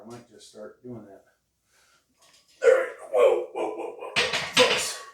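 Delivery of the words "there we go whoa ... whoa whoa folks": strained grunting whoas mid-lift; straining under weight. Real, not acted. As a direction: push out the words under a heavy lift. Straining through a heavy lift.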